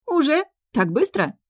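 sudden high-pitched surprise; genuinely surprised. A narrator's reading. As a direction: caught off guard, truly amazed. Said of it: "искренне удивился король".

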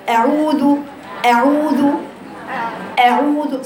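أعوذ with a clean glottal أ from the back of the throat; throat hamza demo. Shown as a demonstration. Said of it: "شاوري على أقصى حلقك".

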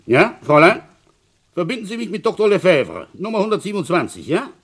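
high and loud into the receiver; loud phone voice. Acted. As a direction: call the operator over a bad line. Speaking into telephone.

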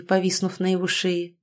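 ordinary delivery, nothing marked

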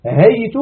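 هيت said "haytu"; rounded "u" closing هيت; damma ending. Shown as a demonstration. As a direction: say هيت as hay-tu. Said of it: "أو مبني على الضم".

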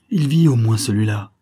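loud, anguished relief; heartrending voice. A narrator's reading. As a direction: torn voice of a grieving father. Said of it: "d'un son de voix déchirant".

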